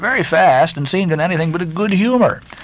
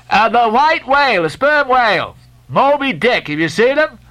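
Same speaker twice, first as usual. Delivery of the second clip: high, raised sailor voice; calling back; 9 semitones higher than usual. A narrator's reading. Stubb pressing the French mate about Moby Dick. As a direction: shout the question across.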